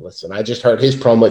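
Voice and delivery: normal talking voice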